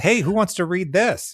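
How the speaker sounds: high, bright hammed-up pitch to readers; mock sales pitch. Writer jokingly pitching a grim book to readers, hammed-up high pitch.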